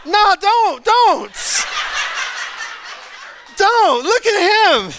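high, laughing "don't"; laughing protest. Real, not acted. To the congregation at the son's photo, laughing. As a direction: laugh while begging them to stop.

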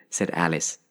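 plain reading voice

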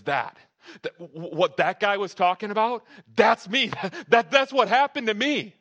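loud, high, stammering outburst; excited dad. Acted. Dad turning round to his kids, thrilled and shouting.

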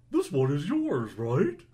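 loud, put-on dinosaur character voice. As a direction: boom it out like a friendly dinosaur. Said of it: "Gotta get the dinosaur voice right".

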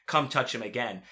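usual speaking voice

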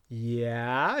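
low, soft, hesitant yeah; reluctant yeah. Acted. Mulder admitting it to Scully, reluctant. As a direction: admit it quietly, rising at the end.

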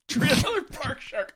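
amused, laughing through the title. Real, not acted. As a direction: chuckle the words out slowly.

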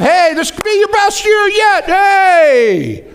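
high, loud cheerful hype; hammed-up excited cheer; excited imitation. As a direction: pump up the crowd like Pastor Brian. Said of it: "Pastor Brian started off".

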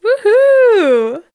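high, loud mock whoop; sarcastic woohoo; ironic cheer. Real, not acted. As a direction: cheer darkly for the joke.

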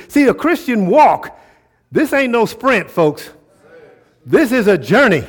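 high, emphatic preacher delivery; forceful preaching. Acted. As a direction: drive each phrase home with punched key words.

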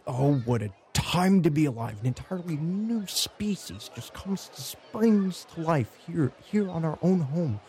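high, excited enthusiast voice; eager, high-pitched naturalist; gushing monster scholar. Acted. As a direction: marvel aloud at a new discovery. Game master plays Volo.

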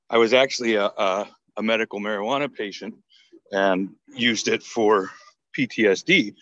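ordinary talk

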